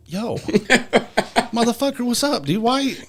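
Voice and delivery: high, laughing exasperation at the runaway dog; exasperated call to dog. Retold or imitated. Dog finally comes back.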